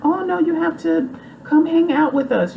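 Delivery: very high, gushing Southern belle voice; sweet, sky-high gushing over a new friend. Retold or imitated. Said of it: "she's a Southern belle".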